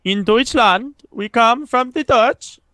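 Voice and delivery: high, slow put-on Dutch accent. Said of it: "Give the kids your best Dutch accent".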